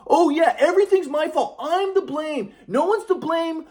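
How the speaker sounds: high mocking old man voice; sarcastic grandpa. Grandpa sneering at grandson in argument, sarcastic. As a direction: needle him like a scornful grandfather. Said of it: "trying to be sarcastic with me".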